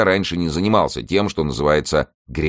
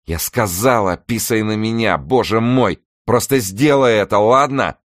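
raised, louder exasperated voice; impatient demand; 6 semitones higher, 4 dB louder than usual. A narrator's reading. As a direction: insist with rising exasperation. Character impatiently repeats her demand. (раздражённое, нетерпеливое требование)

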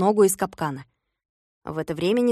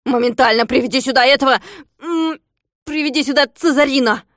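loud, higher impatient command; bossy order; 5 semitones higher, 5 dB louder than usual. Acted. Klops ordering Fex to fetch the dog, bossy shout. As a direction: order the servant about.

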